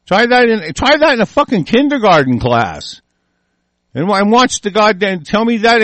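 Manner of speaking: daring the listener, voice up and loud; angry challenge. Real, not acted. Heated rant, repeated challenge.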